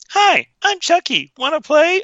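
very high, sing-out toy doll voice; Chucky. Acted. Doll's innocent greeting all film long, high toy voice. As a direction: chirp it like a talking toy. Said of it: "this whole time you just hear".

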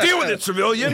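high, barked officer voice; curt military officer. Retold or imitated. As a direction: bark it like a dismissive officer. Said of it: "I thought they'd be like".

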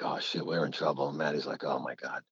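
hushed, whispered oh shit; quietly alarmed at the restaurant table; hushed panic. Retold or imitated. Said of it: "I'm like".

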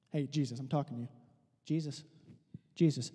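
low, soft, nagging devil; devil pestering Jesus. Acted. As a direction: call for attention quietly and low. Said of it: "He says".

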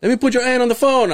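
a hint of rushed dad; imitating dad. Son mimicking dad passing the phone. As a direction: hurry it like dad. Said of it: "my dad always does that".

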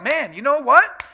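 high, loud mock bravado; bold excitement. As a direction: crow it like a cocky guy. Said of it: "he's like".

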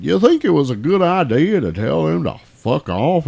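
mock southern deputy; southern yokel accent. Fat deputy questioning the sheriff, southern yokel accent. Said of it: "doing these southern yokel accents".

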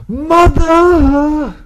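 sudden falsetto cry of Mother; high shouted call. Acted. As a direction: yell it up high like a sound cue. Shouted catchphrase bit.

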